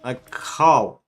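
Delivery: slow, loud, disbelieving rant; exasperated. Real, not acted. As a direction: stretch it out in disbelief. Ranting at bad graphics.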